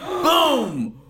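gasp and boom. Pretend. Explosion in jet ski stunt.

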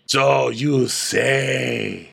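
very slow, drawn-out disdain; Diana. Acted. Diana doubting Sutton, slow theatrical disdain. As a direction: stretch every word dismissively. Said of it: "I'll be Diana".

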